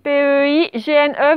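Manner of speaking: ordinary talk, nothing marked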